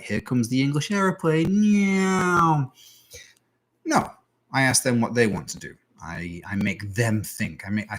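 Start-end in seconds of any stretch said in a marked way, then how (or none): 0.0-2.7 put-on high cooing voice for a toddler; mock baby-feeding singsong; spoon-feeding baby talk.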